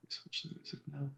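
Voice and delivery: muttered off to the side; quiet aside. Real, not acted. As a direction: say it under your breath, off-mic. Aside to switch the music.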